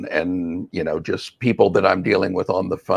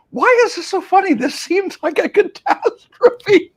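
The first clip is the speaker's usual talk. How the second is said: baffled. Retold or imitated. Acting out own past bewilderment.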